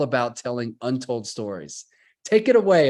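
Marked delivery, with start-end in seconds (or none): 2.2-2.9 loud, high, upbeat introduction; excited handoff. Real, not acted. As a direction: hype up the handoff. Host hands over to guest.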